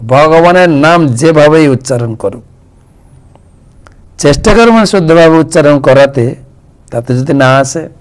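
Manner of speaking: ordinary talk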